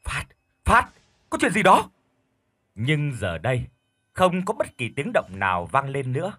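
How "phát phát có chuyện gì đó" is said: frightened, high, loud call; alarmed calling out. Acted. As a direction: shout into the phone in panic. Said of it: "ông liền gọi to".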